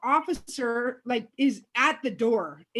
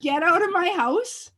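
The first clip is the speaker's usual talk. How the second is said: mock shout; 7 semitones higher, 6 dB louder than usual. Repeating her yell.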